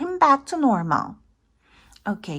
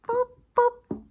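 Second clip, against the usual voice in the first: high beeping "boop boop"; high electronic boop; button beeps; 13 semitones higher than usual. Acted. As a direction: squeak out a button sound. Button press sound effect.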